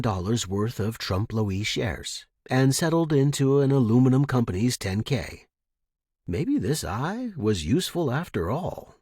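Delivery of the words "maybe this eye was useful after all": ordinary delivery, nothing marked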